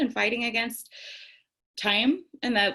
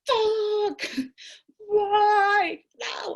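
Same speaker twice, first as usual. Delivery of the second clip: high, cracked cry of frustration; exasperated wail; 11 semitones higher, much slower than usual. Retold or imitated. After dropping the pickle jar, a high frustrated wail. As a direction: wail it like it's the last straw. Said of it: "I was pissed".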